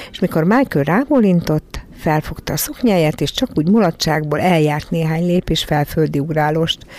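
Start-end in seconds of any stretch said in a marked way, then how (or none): none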